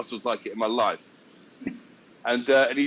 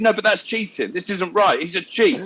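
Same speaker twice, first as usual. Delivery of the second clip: put-on higher-pitched indignant child; eight-year-old's protest; 6 semitones higher than usual. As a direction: mimic a kid crying foul. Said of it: "he's eight years old".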